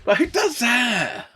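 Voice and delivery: high, drawn-out self-mocking wail; slow, high exasperated cry; incredulous outburst. Real, not acted. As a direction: stretch it out in disbelief at yourself.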